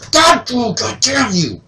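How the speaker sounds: high, loud startled swearing mimicked; startled cursing. Mom startled by son in laundry room, imitated shocked cursing. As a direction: play the scared mom cursing her son. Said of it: "And she'd go".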